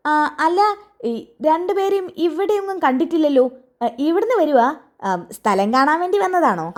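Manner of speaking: high-pitched deaf tea seller asking again; deaf old shopkeeper. Acted. Deaf tea shop owner voiced. (ചെവി കേൾക്കാത്ത ചായക്കടക്കാരൻ്റെ ഉയർന്ന ശബ്ദം)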